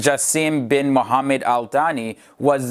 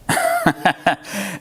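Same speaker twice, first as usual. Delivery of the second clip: high chuckling laugh; 6 semitones higher than usual. Real, not acted. Laughing after listing ways to say Qatar, amused chuckle. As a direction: chuckle in a higher pitch.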